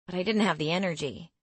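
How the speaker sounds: ordinary delivery, nothing marked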